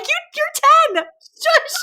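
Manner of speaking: mock scolding mom. Mom shushing her ten-year-old son, high mock scold. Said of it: "And I'm like".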